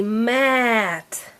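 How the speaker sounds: "mat" with a long held vowel; said slowly. Shown as a demonstration. Teacher modelling a word for a child, said slowly. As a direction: stretch "mat" out sound by sound. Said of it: "say the word slowly".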